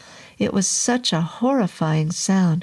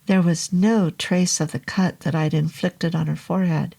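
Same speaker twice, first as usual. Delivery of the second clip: plain reading voice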